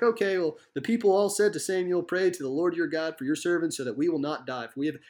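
high, light sing-song recitation; casual sing-song reading that glosses over the words. Acted. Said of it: "that's not this sing-song voice".

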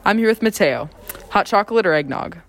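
ordinary delivery

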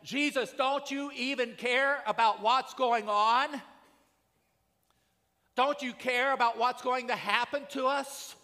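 high, loud, desperate plea, slowed down; panicked disciples. Retold or imitated. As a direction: cry out to Jesus over the storm. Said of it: "the disciples are going".

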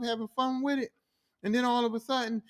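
ordinary delivery

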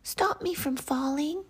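high, squeaky little plea for help; pleading snowflake. A narrator's reading. Snowflake begging the cloud for help, high pleading. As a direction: beg in a tiny high voice. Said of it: "pleaded the snowflake".

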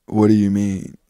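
a hint of a low-talking teacher; imitating a teacher. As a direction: do a low-voiced impression of an old teacher. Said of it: "he always talking like that".

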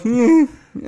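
high, loud mock grunts; imitated grunts. Host imitating deaf customer, grunting. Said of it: "you're doing grunts".

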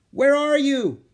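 loud, high searching call; shouting. A narrator's reading. Jack hunts for Annie in the forest, shouting. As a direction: yell to someone out of sight.

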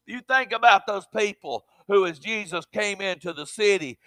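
usual speaking voice

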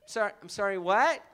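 put-on baffled American accent, rising "what"; mimicked American accent. American failing to understand again, mock American accent. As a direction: keep being the confused American.

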